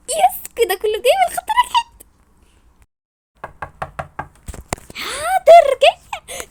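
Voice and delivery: bright excited friend voice; high girl's voice. Acted. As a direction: squeal it like an eager kid friend. Second girl voiced high.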